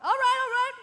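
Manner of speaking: normal talking voice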